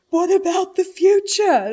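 high, loud panicked wail; retelling a new parent's panic, loud and high; panicked cry. Retold or imitated. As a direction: cry out in sudden fear for the future. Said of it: "And I was like".

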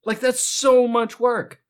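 normal talking voice

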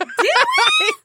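high laughing question; laughing disbelief. Real, not acted. Incredulous laughing reply.

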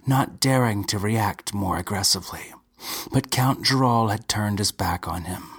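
plain reading voice

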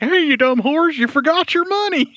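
put-on very high, loud crusty old-timer; old prospector. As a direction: yell it like a crazy old prospector. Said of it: "cootie old prospector".

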